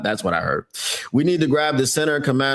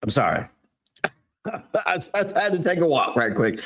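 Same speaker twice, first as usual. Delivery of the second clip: usual speaking voice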